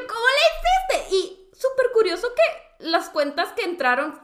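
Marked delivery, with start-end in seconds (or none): 0.1-1.1 loud, very high question; reenacted amazed shout; astonished shriek. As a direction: squeal it in thrilled disbelief. Retold astonishment at success.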